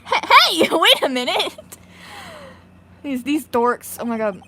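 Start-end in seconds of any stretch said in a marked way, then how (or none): 0.0-1.5 loud, higher-pitched flustered calling; excited shouting. Acted. Man spots people he knows and runs, flustered shouting. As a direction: shout in startled recognition, then hurry off. Old friend calling out, then fleeing.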